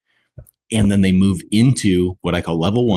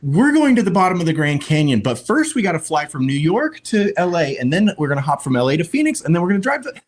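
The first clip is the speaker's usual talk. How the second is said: much higher, chatty mock wife rambling on; long-winded woman; 10 semitones higher than usual. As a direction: lay out every step like a rambling story. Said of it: "women sometimes are like".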